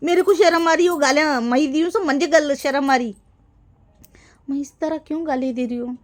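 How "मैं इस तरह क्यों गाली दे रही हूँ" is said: whispered, asking herself in dismay. Real, not acted. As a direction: breathe the question to yourself. Self-question amid the rant. (खुद से फुसफुसाकर पूछना)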